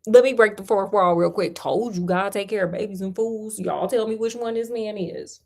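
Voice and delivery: plain reading voice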